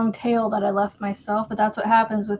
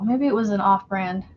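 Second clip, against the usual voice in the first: usual speaking voice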